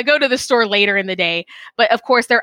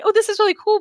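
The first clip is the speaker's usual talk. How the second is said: much higher, gushing thanks; high, polite delight; 12 semitones higher, faster than usual. Retold or imitated. Thanking husband for margarita glasses, high mock delight. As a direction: play up the delight at the gift. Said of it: "I was like".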